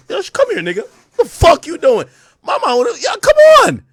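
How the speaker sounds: high, exclaiming in disbelief; outraged exclamation. Real, not acted. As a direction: cry out at the picture in shock. Reacting to gun picture, outraged.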